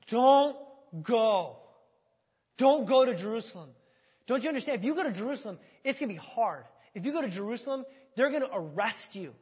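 loud, high, urgent begging; desperate pleading. Acted. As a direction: beg him emphatically, pitch rising as you go. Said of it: "begged him, pleaded with him".

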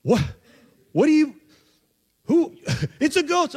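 high, stammering mock panic; hammed-up fright, words bursting out; mock freak-out. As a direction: act out a startled, high-pitched scare. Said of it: "they're freaking out".

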